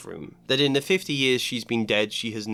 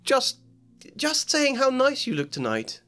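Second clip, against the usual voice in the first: flustered schoolgirl; 14 semitones higher than usual. Acted. Hermione flustered, high.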